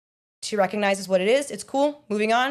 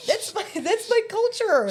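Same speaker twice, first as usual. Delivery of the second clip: high, laughing mock protest; squeaky indignant fan voice; mock offended fan; 13 semitones higher than usual. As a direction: whine it like an insulted fan. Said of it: "the person that did it does it again".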